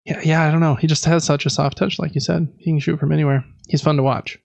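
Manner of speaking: ordinary delivery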